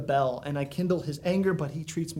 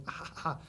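soft breathy groans of holy agony; groaning in agony; 9 dB quieter than usual. Retold or imitated. God groaning inwardly over his people, quiet groans. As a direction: let out a quiet pained groan. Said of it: "inward groaning of holy agony".

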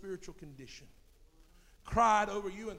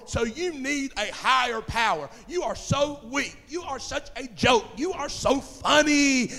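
mocking scoffers; 5 semitones higher, 9 dB louder than usual. Voices mockers sneering at believers.

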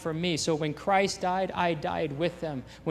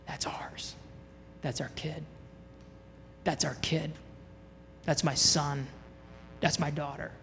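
hushed, tender fatherly pride, softer; tender proud whisper; 3 semitones lower, 10 dB quieter than usual. Acted. God the Father whispering proudly over his child, hushed and tender. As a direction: barely breathe it, like a proud father.

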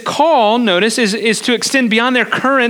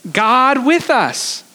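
slow emphasis; much slower than usual. Acted. Answering his own question.

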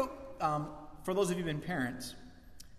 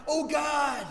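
high, loud cry to God; Jesus crying out to the Father; anguished cry; 10 semitones higher, 6 dB louder than usual. Retold or imitated. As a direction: cry out in desperate prayer.

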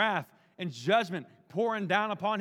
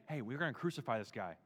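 hushed and low, like plotting; conspiratorial hush; 7 semitones lower, 7 dB quieter than usual. Retold or imitated. Leaders murmuring to one another, softer and lower. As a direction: keep it secret between leaders.

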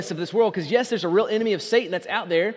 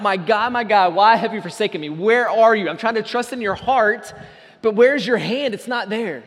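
crying out; 3 semitones higher, 6 dB louder than usual. Retold or imitated. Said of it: "People that are crying out".

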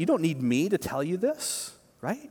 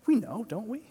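ordinary talk, nothing marked

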